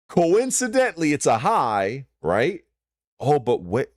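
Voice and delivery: ordinary talk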